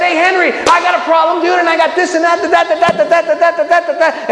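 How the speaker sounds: high, insistent motormouth monologue; mock breathless rambling. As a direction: rattle on without letting him in. Acts out endless rambling at friend.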